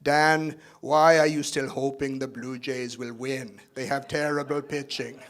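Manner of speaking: put-on slow, heavy Austrian accent, lightly done; fond Austrian-accent impression of a sports-loving dad; imitating father's Austrian accent. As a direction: do your dad's Austrian-accented English, slowly. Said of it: "he still has this Austrian accent".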